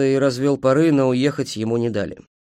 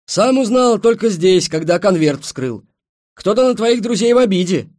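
defensive protest; 9 semitones higher, 8 dB louder than usual. A narrator's reading. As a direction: protest your innocence loudly. Driver defends himself. (оправдываясь, громко и высоко)

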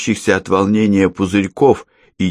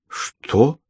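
high startled what; taken aback; 7 semitones higher than usual. Acted. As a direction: caught off guard, stunned. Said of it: "опешила Яна Владимировна".

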